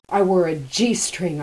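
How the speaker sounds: cried out. A narrator's reading. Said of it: "I cried".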